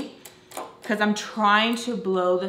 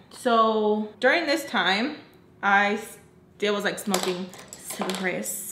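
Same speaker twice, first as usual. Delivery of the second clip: usual speaking voice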